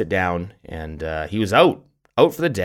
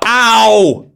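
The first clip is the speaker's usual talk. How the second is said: loud, high mock "ow"; sudden acted pain cry; imitated yelp of pain; 12 semitones higher, 7 dB louder than usual. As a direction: yelp as if suddenly stung, hammed up. Acts son stung mid-cheer.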